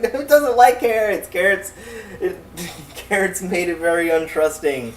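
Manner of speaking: high voice breaking into laughs; laughing. Real, not acted. Telling mom the bunny hates carrots, laughing. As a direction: giggle through the excuse.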